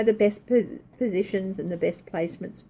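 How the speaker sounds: normal talking voice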